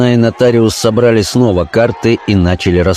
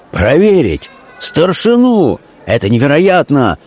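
high, drawn indignant disbelief; embittered voices; 6 semitones higher than usual. A narrator's reading. Angry players hearing the demand for a check, indignant. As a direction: exclaim in angry disbelief, higher. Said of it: "послышались озлобленные голоса".

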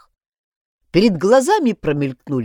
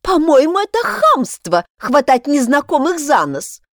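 high, loud, offended outburst; indignant; 9 semitones higher, 5 dB louder than usual. A narrator's reading. Antenna indignant at being grabbed by the nose, outraged. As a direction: bristle with outrage at the stranger. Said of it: "возмутилась антенна".

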